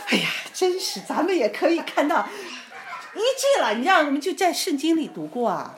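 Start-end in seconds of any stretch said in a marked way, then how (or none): 0.1-3.7 high, loud, laughing wonder; elated amazement. Retold or imitated. Church friends marvelling at a healing, elated amazement. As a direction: retell a whole group floating with excitement. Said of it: "我们突然都飘到天上了".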